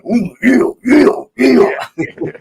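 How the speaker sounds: high gravelly growl; Macho Man impression. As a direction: ooh yeah drawn out high. Imitating Macho Man Randy Savage.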